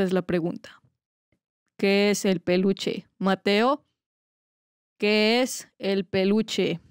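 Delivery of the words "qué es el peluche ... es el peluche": very slow, deliberate interrogation; drawn-out questioning held in check; controlled calm version. Pretend. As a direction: press the question slowly, word by word. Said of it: "la versión tan tranquila".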